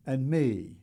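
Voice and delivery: usual speaking voice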